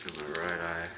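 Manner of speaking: teary, quiet, stinging eyes; eyes tearing up. Real, not acted. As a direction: talk through watering eyes. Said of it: "That's why it keeps tearing up".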